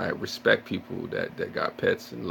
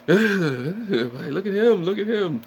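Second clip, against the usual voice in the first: high, very slow put-on voice; mocking onlooker; 11 semitones higher, 5 dB louder, much slower than usual. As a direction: drawl it out like a mocking bystander. Onlookers mocking him fleeing rats.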